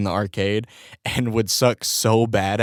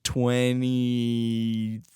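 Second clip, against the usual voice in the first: usual speaking voice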